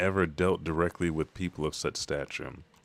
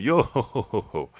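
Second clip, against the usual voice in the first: high, loud first note, then dropping away; mock "yo-ho-ho" laughter; character's belly laugh; 5 semitones higher, 4 dB louder than usual. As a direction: imitate a jolly cartoon laugh. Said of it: "eating too and going".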